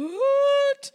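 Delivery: high, loud baffled what; startled kid, totally lost; confused child. Retold or imitated. Child puzzled by mom's outburst, mock high startled. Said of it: "The child's going".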